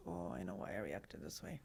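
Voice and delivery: a hushed inner voice; quiet realization. Retold or imitated. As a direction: say it under your breath as it dawns on you. Inner realization after the fact.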